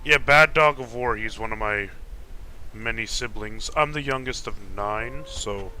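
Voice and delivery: ordinary delivery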